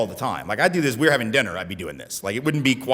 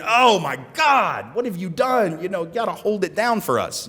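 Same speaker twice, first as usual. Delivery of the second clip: raised, slowed, alarmed pleading; a hint of anxious dismay, pitched up; mock alarm; 7 semitones higher than usual. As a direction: imitate an anxious onlooker begging him to hold it down. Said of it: "it would have been".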